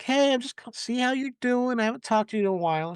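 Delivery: a hint of a mom's casual check-in; mom calling. Mom phoning to check in on her daughter, lightly voiced and casual. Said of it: "her mom was just calling".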